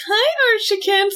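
sweet high come-on; Cheryl voice. Retold or imitated. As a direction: coo it like a vamp. Cheryl voiced in retold comic.

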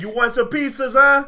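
high, punchy fake Boston accent; mock Boston accent. Proving he sounds like The Departed, mock Boston accent. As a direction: do a cheeky movie-Boston accent. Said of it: "just like the characters in The Departed".

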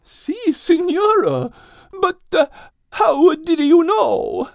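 put-on high, slow Italian-accented wonder; amazed question. A narrator's reading. Pauline amazed that the animals know the cloud, high and slow. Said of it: "Pauline asked with amazement".

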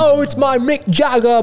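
put-on high, wide-mouthed British accent; British accent with the mouth stretched wide open. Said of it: "My [name] is just a British accent".

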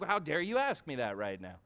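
low, offended imitation; mock indignation. Voices an offended churchgoer.

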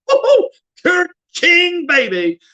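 high, loud celebratory whoop; excited whooping cheer. Real, not acted. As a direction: whoop it up, then drop on the last word. Cheering a viewer's news.

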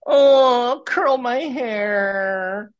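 very high, drawn-out mock whine; whiny crybaby. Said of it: "this little crybaby, whiny".

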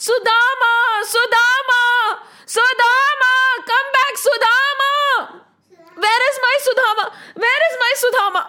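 acted desperate hollering; frantic shouting. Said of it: "like a crazy man shouting".

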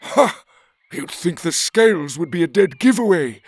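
high, loud scornful lizard voice with a sharp Ha; snarling. A narrator's reading. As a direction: sneer at the excuse, loud. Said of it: "the gizzard snarled".

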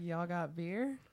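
put-on Texan accent again, soft; mild Texan twang on y'all; lightly put-on Texan accent; Texan drawl. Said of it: "I was just like".

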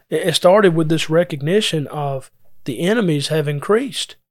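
ordinary talk, nothing marked

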